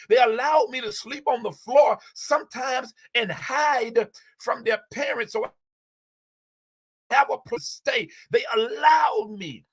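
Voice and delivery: getting emotional. Real, not acted. Remembering friends who sheltered her when homeless, getting emotional. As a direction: let the feeling spill into the memory. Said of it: "I'm getting a little emotional now".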